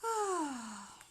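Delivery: falling, drawn-out sigh; audible exhale that slides downward; long sigh. Real, not acted. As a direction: let out a long breath, dropping in pitch. Loud sound between remarks.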